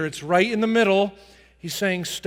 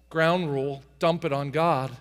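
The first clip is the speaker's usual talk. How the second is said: ordinary delivery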